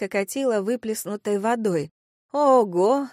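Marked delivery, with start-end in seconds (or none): 2.3-3.1 higher, louder exclamation; surprise. Acted. Narrator smells fragrant water on girls, surprised. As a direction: let out an amazed wow. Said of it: "удивилась тонкому запаху".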